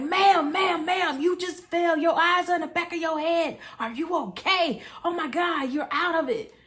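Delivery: high, loud, alarmed man calling to her; a panicked passerby shaking her awake; worried stranger. Retold or imitated. Stranger rushes to fallen woman.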